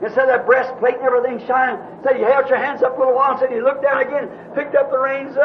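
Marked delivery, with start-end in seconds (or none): none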